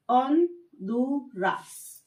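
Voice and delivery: ऑनडुरास slowly, silent h, rolled r; slow on-DU-ras; Spanish pronunciation. Shown as a demonstration. Teacher models Spanish Honduras slowly. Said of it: "इसको बोला जाता है".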